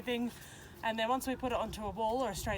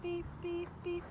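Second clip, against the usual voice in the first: high, quiet beeping cue to the dog; 7 semitones higher, 4 dB quieter, slower than usual. Shown as a demonstration. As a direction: chirp the beeps softly and high, like a signal. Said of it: "when I beep, she backs up".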